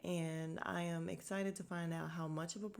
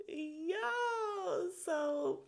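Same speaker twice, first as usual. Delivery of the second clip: laughing yo; 12 semitones higher, 5 dB louder than usual. Real, not acted. Bursting laugh at scene.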